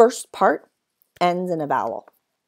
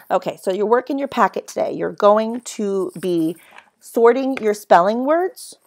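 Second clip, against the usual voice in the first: ordinary talk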